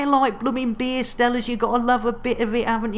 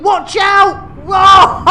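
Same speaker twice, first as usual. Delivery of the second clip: alarmed shout; 10 semitones higher, 9 dB louder, much slower than usual. Real, not acted. Ship nearly crashes on landing.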